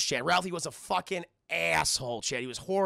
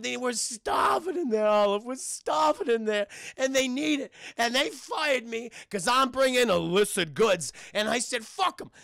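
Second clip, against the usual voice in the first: raised, indignant mock-tearful protest; impassioned rant; 4 semitones higher, 4 dB louder than usual. Fired penguin pleads for the starving penguins, impassioned rant. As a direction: build a hammed-up case for the defence. Crying penguin rants about his firing.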